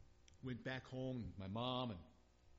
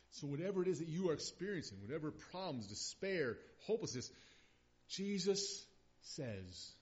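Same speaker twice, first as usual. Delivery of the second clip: rising preacher's fervour, louder; preaching; 5 semitones higher, 6 dB louder than usual. Acted. Preacher urging a grieving congregation, fervent and loud. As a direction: build it up from the pulpit.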